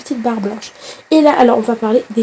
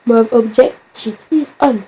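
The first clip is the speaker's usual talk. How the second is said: a hint of French accent on English game text. Shown as a demonstration. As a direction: read the English message in a light French accent. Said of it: "je parle avec l'accent français". (accent français léger sur une phrase anglaise)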